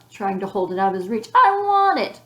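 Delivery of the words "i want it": much higher, louder whiny demand; tantrum yell. A narrator's reading. Ramona clutching Wayne's lollipop, tantrum yell. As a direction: yell it like a greedy little child.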